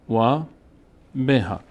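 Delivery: usual speaking voice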